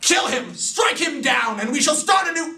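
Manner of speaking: hammed-up villain order; mock villain command. Brainiac ordering Mon-El to kill, shouting high.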